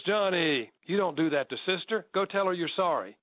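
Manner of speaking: lightly voiced mom telling off her boy; scolding mother. Mother telling Johnny to apologize to his sister, mild mock scold. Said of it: "his mother says".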